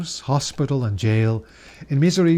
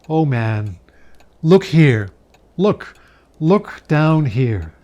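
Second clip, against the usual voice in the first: louder, pressing call to look; urgent command; 6 dB louder, slower than usual. Acted. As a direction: insist he look down, ever more urgent. Spirit shows the children.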